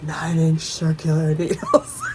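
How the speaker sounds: low, slow creepy voice; creepy imitation. Imitating a creepy voice heard at bedtime, low and slow. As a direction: drag it out low, like a creep in the headphones. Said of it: "it's creepy when you have your headphone on".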